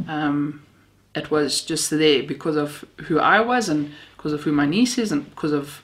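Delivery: ordinary delivery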